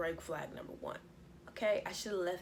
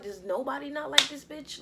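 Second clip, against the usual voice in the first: louder, higher disbelief; exasperated outburst; 7 semitones higher, 7 dB louder than usual. Retold or imitated. Asking herself why nobody likes the host, exasperated. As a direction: throw your hands up out loud.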